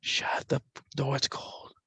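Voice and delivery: soft unvoiced whisper. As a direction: breathe it out like a ghost on the tape. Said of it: "you hear a whisper now".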